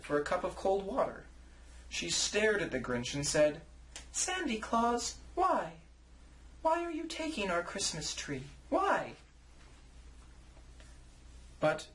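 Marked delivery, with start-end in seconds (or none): 4.1-9.1 put-on high, small child's voice; little girl Cindy Lou. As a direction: ask like a puzzled two-year-old. Tiny Cindy Lou questions Grinch.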